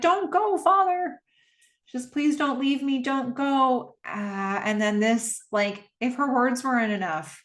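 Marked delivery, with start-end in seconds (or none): 0.0-4.0 put-on high, pleading child's voice, begging; a small daughter begging her dad to stay; pleading little girl. Daughter begging her father not to leave, high child's plea. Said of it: "Soo-ann's like".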